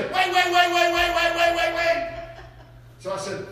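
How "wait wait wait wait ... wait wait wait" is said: high, loud repeated calling of wait. Retold or imitated. Friend on the phone calling to get the preacher back, urgently. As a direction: call out to get his attention.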